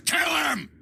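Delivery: very high, furious cry; shouted kill order. Acted. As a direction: scream the order to attack. Villain orders an attack.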